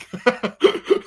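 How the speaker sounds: sudden high laugh; a bright real laugh at the memory; high burst of laughter. Real, not acted.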